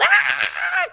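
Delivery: very high loud scream of fright; startled scream. Acted. Lady screaming in fright at Lance's face. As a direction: scream and fall over. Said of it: "The lady yelled".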